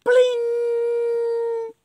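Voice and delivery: very high, loud drawn-out pling; high ringing pling. Acted. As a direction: ring it out like a tiny bell. Sound effect in the story.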